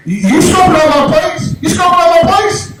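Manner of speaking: high-pitched shouted accusation; accusing dog owner. Retold or imitated. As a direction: hurl the question at an intruder. Owner accuses him of casing the place.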